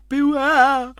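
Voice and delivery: huge, drawn-out theatrical cry; hammed-up vicar's warning. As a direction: bellow a slow, high warning. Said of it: "the vicar was like".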